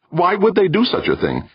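loud, high-pitched indignant question; exasperated challenge; demanding. A narrator's reading. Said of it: "Meek demanded again".

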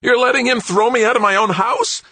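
very high, loud and shrill disbelief; voice climbing an octave. Acted. Son to mother, voice climbing an octave. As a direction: let the voice leap an octave up. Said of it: "Greg's voice climbed an octave higher".

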